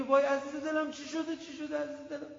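put-on high, fussing mother comforting her child; worried mother. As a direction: coo like a mum whose child has fallen. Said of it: "میگه".

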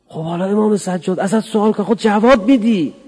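high, loud challenge; mock challenge. Preacher challenging listeners to answer, high and loud. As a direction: press them for an answer.

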